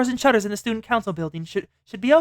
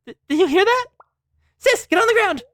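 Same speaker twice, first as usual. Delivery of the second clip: high, loud warning yell; urgent shout; 11 semitones higher, 4 dB louder than usual. Acted. Warning sister of attack.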